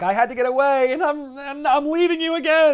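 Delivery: high, loud theatrical wail; dramatic Mary Jane. Retold or imitated. Mary Jane leaving Peter, high hammed-up wail. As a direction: ham up the tearful exit. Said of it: "she's like".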